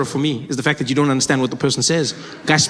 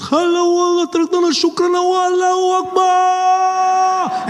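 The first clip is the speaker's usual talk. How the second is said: high-pitched mock-Arabic battle cry, last word drawn out; ranting mock terrorist; 13 semitones higher, slower than usual. Man with a suicide vest losing his head, mock ranting cry. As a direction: rant in fake Arabic on one high note.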